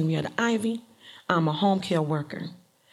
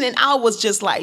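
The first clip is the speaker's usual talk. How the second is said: ordinary delivery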